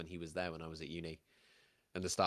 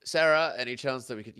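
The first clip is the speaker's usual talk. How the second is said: lightly voiced guard hailing the barmaid; a guard trying to get attention; guard calling over; 4 semitones higher, 9 dB louder than usual. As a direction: call across the bar, louder and higher. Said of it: "one of the guards sort of looks up and goes".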